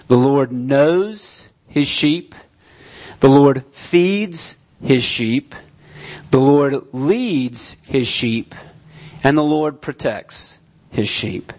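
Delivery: slow, weighty, each line landing: knows, feeds, leads, protects; slow emphatic list. Shown as a demonstration. Pastor presenting four points, slow and emphatic. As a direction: lay out each truth slowly and firmly. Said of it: "here are the four characteristics".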